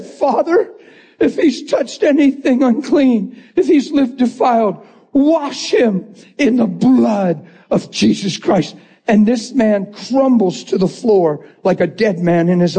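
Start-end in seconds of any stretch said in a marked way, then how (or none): 0.0-8.7 high-pitched pleading, slowing at the end; fervent tearful prayer. Retold or imitated. Preacher praying over Bill to be washed, fervent. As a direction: cry out to God for him.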